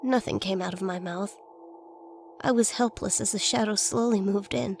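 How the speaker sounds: ordinary delivery, nothing marked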